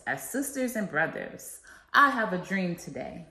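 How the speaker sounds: rousing oratory with a preacher's cadence; formal speech. Acted. Student reciting the I Have a Dream speech to listeners, rousing oratory. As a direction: proclaim each line like a civil rights speech to a crowd.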